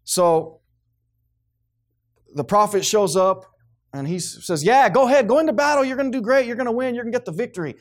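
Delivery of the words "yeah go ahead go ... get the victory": sarcastic. Retold or imitated. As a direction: cheer them on insincerely, high-pitched. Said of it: "He knew it was sarcasm".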